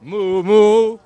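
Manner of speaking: high, loud playful moo; cow mooing. Pretend. Count echoing a child dressed as cow, playful mooing. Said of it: "I'm a cow".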